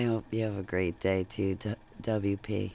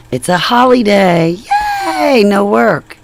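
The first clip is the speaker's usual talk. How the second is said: higher and louder, slow happy cheer; gleeful cheer; 11 semitones higher, 18 dB louder than usual. Real, not acted. Declaring a holiday to viewers, gleeful cheer. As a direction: celebrate the day off out loud.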